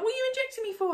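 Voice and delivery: high-pitched retold protest; indignant disbelief. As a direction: shoot up high in baffled objection. Retold protest at surprise injection.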